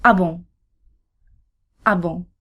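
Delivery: "Ah bon?" with a nasal bon, spoken twice for repeating; teaching the phrase Ah bon. Shown as a demonstration. Teacher models "Ah bon?" for learners. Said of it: "This little expression is perfect for when you want to express surprise".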